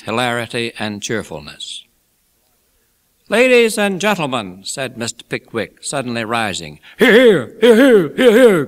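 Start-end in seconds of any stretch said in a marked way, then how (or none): 3.3-4.6 high, loud public address; Pickwick speechmaking. A narrator's reading. Pickwick rises to address wedding guests, high loud oration. 7.0-8.7 loud, high cheering from the audience; excited cry. A narrator's reading. Mister Weller cheers Pickwick's speech, excited high shouts. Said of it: "cried Mister Weller in the excitement of his feelings".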